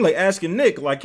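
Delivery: ordinary talk, nothing marked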